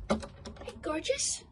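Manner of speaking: soft, high-pitched affectionate greeting; cooing to pet. Real, not acted. Owner greeting the cat in the quiet house, cooing. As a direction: coo it softly, voice lifted high.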